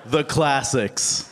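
ordinary talk

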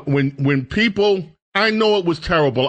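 ordinary delivery

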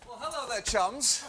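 hammed-up posh British accent. To his old coworkers, a hammed-up posh British accent. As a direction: toff British greeting to chums. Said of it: "that horrible British accent".

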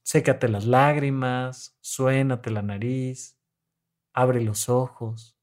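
calm them gently after the crying; quiet reassuring voice, slowed right down; soothing therapist. Retold or imitated. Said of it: "ya ahí les digo".

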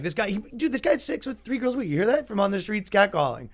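high, fast, excited stage hype; hype man intro. Retold or imitated. Comedian hyping his guest to the crowd, high and excited. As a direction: pump up the crowd about this guy.

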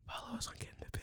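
very soft, voiceless whisper. Real, not acted. Kid whispers the podcast sign-off to tease parent, whispering. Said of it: "non-whispering voice".

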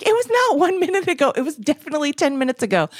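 high, fast indignant protest; indignant insistence. Retold or imitated. Bee-stung friend protesting how long ago it was, high and fast. Laura insisting on sting time.

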